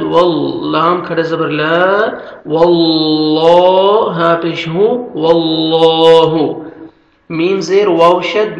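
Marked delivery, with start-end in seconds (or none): 2.5-4.1 واللہ with a thick, full lam; heavy lam in Allah. Shown as a demonstration. Teacher showing the heavy lam of Allah. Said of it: "پر بھی کرنا ہے اور موٹا بھی". 5.1-6.5 slow, melodic tajweed chanting; Quran recitation. Shown as a demonstration. Teacher reciting the verse for students to repeat.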